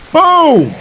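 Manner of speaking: very high, loud exclamation; big explosive hit call; triumphant shout. Real, not acted. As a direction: shout it as the blow lands. Landing a hit on the boss.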